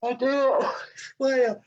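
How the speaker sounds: talking through a yawn; words stretched by a yawn. Real, not acted. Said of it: "Why am I yawning?".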